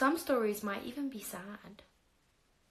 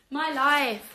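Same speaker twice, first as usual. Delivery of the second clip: exasperated exclaim; 6 semitones higher, 10 dB louder than usual. Real, not acted. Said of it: "exclaiming about own life".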